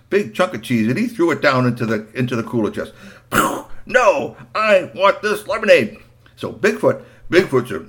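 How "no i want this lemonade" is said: put-on insistent boy. Boy arguing with Bigfoot.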